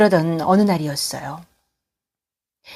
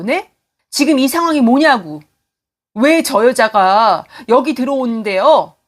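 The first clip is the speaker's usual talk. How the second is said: loud, pitched-up outrage at the intruding mother; angry confrontation; 5 semitones higher, 4 dB louder than usual. Acted. Son yelling at his runaway mother to get out. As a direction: let your fury boil over, voice climbing.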